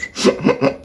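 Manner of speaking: quick run of voiceless hiccup noises; involuntary little stuttered hiccups; soft, repeated hiccup tic. Real, not acted. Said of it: "make that hiccup noise".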